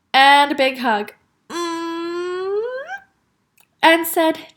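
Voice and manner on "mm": long, high, rising "mmm"; hug noise. A narrator's reading. Taya hugging her father, straining hum. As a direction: squeeze out a hum.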